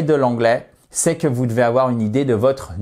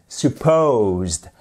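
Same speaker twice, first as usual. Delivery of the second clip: "supposed" with the push on "posed"; stress on second part; 2 semitones lower than usual. Shown as a demonstration. Teacher modelling "supposed" for learners, stress on "posed". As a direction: say "supposed" leaning on its end. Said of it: "accentuation sur la seconde partie du mot".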